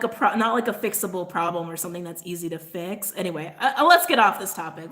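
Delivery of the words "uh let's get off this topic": ordinary talk, nothing marked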